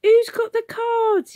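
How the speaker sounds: very high, loud, slow Cockney woman; yelled. A narrator's reading. Woman shouting to Alf in the raid, yelling. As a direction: bawl into the dark. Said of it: "yelled a woman".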